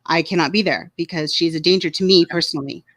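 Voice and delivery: ordinary talk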